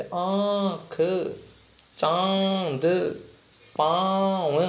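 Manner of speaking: आँख, चांद, पाँव said slowly with nasal vowels; chandrabindu demo. Shown as a demonstration. Teacher demonstrating chandrabindu words slowly and nasally. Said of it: "ये दोनों व्यंजन के ऊपर लगते हैं".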